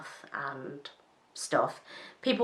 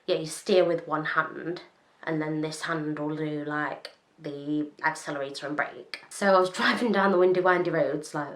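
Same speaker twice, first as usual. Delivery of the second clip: ordinary talk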